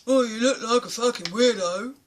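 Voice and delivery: loud jeering yell from across the road; jeering heckler across the road, loud; shouted insult. Retold or imitated. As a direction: bark it like a street heckler. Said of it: "a guy across the road goes".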